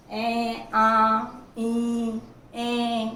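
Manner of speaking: "ए, आ, ई" each sounded through the nose; drawn-out nasal vowels for the class; nasal vowel demo. Shown as a demonstration. Teacher shows nasal vowels to students, sounding each through the nose. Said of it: "इस तरह से इनका उच्चारण हो जाएगा".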